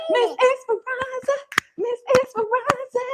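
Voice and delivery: put-on very high squeaky baby voice. Shown as a demonstration. Mom modelling a high baby voice for the baby to copy. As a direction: say it the way the baby should, high and sweet.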